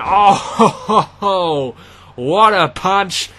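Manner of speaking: loud, slow laughing exclamation; amazed laughing shout. Acted. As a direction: marvel at the hit with a laugh. Big punch lands, laughing.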